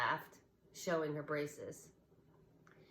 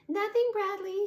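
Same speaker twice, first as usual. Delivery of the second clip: much higher, louder little rabbit voice; a giggly stuffed bunny covering up; squeaky toy rabbit; 8 dB louder than usual. A narrator's reading. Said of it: "she said".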